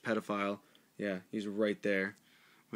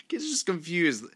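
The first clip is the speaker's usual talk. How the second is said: high, laughing through the words. Real, not acted. Reader laughs at the lost boy's picture, talking through laughter. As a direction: crack up while commenting.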